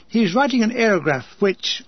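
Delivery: ordinary delivery, nothing marked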